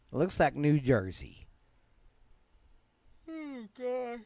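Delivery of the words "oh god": high, soft yawn through words. Real, not acted. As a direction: say it mid-yawn. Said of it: "I'm sorry I'm yawning".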